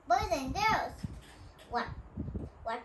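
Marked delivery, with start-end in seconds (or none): none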